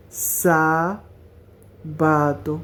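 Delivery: very slow broken syllables; সাবাতো split into slow syllables. Shown as a demonstration. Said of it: "এভাবে syllable ভাঙা ভাঙা".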